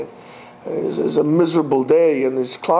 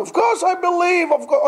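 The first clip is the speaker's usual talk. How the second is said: high insistent reassurance; eager protest; 13 semitones higher than usual. Retold or imitated. Climber insists to God he believes, high. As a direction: swear you believe. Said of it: "climber answers God".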